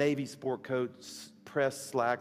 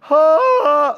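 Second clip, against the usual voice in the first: high, very loud crying howl; hammed-up heaving sob, one long blast; loud imitated wail; 19 dB louder than usual. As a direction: bawl so the whole plane hears. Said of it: "like that loud, not, not little teary thing".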